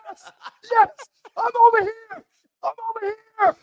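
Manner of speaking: very high, frantic mock cry of relief; eager calling out. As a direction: answer the roll call as if desperate to get out. Prisoner eagerly answering his name.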